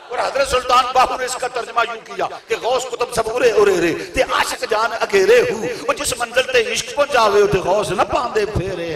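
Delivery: loud impassioned sermon voice; fiery preaching. Acted. Preacher rousing the gathering on love and reason, fiery. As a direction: drive the words at the congregation.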